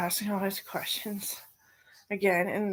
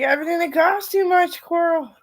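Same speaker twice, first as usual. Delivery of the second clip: high, loud teasing answer; mock sarcasm; 10 semitones higher, 12 dB louder than usual. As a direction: tease her daughter back, exaggerated. Mom's retold sarcastic reply.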